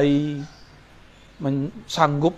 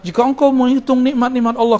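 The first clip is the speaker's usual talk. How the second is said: lightly imitated preacher, pitched higher; a hint of khutbah delivery, raised pitch easing down at the end; imitating sermon preacher; 7 semitones higher, 4 dB louder than usual. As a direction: lightly mimic a preacher's lifted voice. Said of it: "dicekokin dalam khutbah-khutbah".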